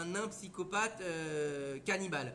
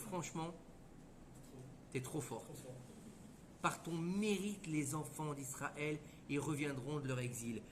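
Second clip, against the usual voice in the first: softened concession; 5 semitones lower, 8 dB quieter than usual. Acted. God yields to Rachel.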